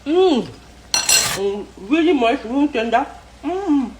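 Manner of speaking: chewing while talking; savoring through a bite; mouth full. Real, not acted. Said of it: "take another bite of chicken".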